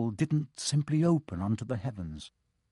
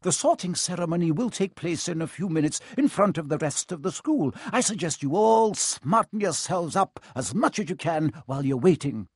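higher, crisp schoolmistress voice; stern professor; 6 semitones higher, 3 dB louder than usual. A narrator's reading. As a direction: address the students briskly and firmly. McGonagall addresses first years.

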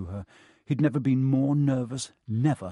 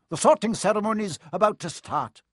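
higher, louder, brisk announcement; sharp professor; 9 semitones higher, 5 dB louder than usual. A narrator's reading. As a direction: announce it firmly. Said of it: "said a sharp voice".

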